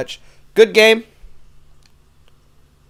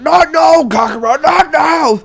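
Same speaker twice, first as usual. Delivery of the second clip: loud, high exasperated yelling; hammed-up anime warrior losing it; frustrated Vegeta; 11 semitones higher, 6 dB louder, slower than usual. Vegeta interrupted by Goku mid-show, frustrated yell. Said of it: "Vegeta just getting really frustrated with Goku".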